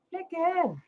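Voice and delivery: Flicking said high and soft; playful high repeat. Pretend. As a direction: toss the word Flicking off lightly.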